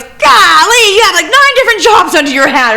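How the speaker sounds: high, loud, exasperated mock voice; mocking city guy. City official mocked, exasperated at Jason's many jobs, high loud squeal. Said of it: "And the guy goes".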